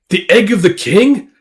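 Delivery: high voice rising on KING; disbelieving question. Acted. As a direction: repeat it as an incredulous question. Repeats it in disbelief.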